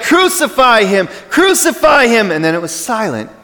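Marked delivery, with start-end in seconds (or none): 0.0-2.3 higher and louder mock crowd chant; crowd yelling. Crowd yelling at Pilate, raised mock chant. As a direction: chant it up high like an angry mob. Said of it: "when the crowd yelled".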